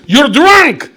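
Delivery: high, loud, dismissive shout; angry shout. Acted. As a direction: slam the order shut. Commissar cancels operation.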